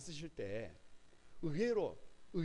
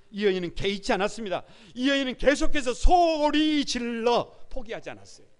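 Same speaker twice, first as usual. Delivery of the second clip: higher and much louder impassioned sermon; preaching at full force; 9 semitones higher, 12 dB louder than usual. Acted. Pastor urging the congregation to cry out, full force.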